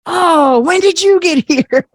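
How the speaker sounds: high surprised mom voice; imitating mom, high and startled. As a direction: play mom, startled to find her there. Mom surprised to see her.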